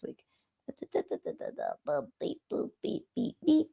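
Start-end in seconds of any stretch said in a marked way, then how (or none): 2.2-3.7 soft little spoken beeps; imitated beeping. Distracted host busy with a task, soft imitated beeps. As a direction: beep quietly, barely voiced.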